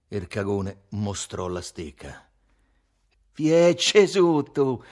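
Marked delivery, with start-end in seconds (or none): 3.3-4.8 loud, drawn-out high cry; shout. A narrator's reading. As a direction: shout it out slowly, stretching the words. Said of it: "gridò il matto".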